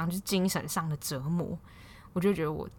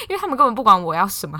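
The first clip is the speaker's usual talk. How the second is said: laughing; 4 semitones higher, 9 dB louder, faster than usual. Real, not acted. Laughs about close friends.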